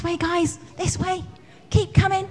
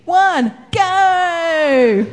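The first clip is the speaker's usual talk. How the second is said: loud call to start; shouted go; 7 dB louder than usual. Real, not acted. Starts the ball throwing.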